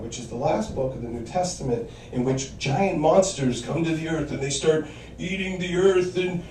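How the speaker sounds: usual speaking voice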